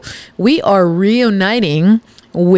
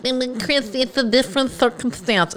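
softer, higher pleading voice of a listener; whiny listener excuse; 5 semitones higher, 6 dB quieter than usual. Retold or imitated. Host mocks a listener messaging her excuses, softer, higher and wheedling. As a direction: mimic a fan making excuses, small and wheedling. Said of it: "I don't want you guys messaging me like".

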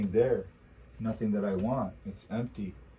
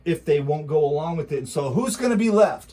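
louder, higher indignant warning to listeners; indignant rant; 4 semitones higher, 7 dB louder than usual. Real, not acted. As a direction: hammer home the outrage. Ranting about military purge.

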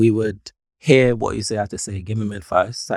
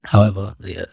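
usual speaking voice

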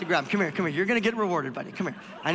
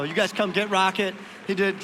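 ordinary talk

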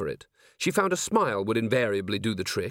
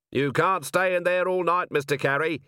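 raised, higher-pitched call into the dark; 6 semitones higher than usual. A narrator's reading. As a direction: call to someone hiding down an alley. Calling to man hiding in alley.

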